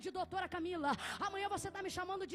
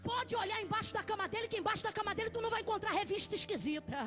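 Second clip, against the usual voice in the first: full-force preaching; 2 semitones higher, 6 dB louder than usual. Acted. Preacher voicing God daring Satan, full-force preaching. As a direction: escalate each challenge until shouting.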